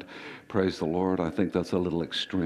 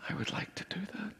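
a quick, longing whisper; wistful whisper; 13 semitones higher, 13 dB quieter, faster than usual. Retold or imitated. His flesh giving in to going home, a wistful whisper. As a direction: admit the secret wish under your breath. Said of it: "my flesh would be like".

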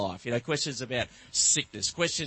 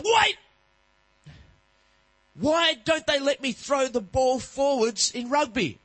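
put-on high, slow excited boy; child interrupting; 10 semitones higher, 5 dB louder than usual. Son cutting off dad's answer, high slow child voice. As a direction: burst in with a new kid question.

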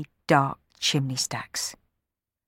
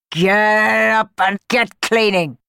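loud, slow, higher bullying boom; menacing boom; 6 semitones higher, 6 dB louder, slower than usual. Acted. Sweep bellowing at the boy in the chimney, loud menacing boom. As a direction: bellow the order to scare him. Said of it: "boomed Bob menacingly".

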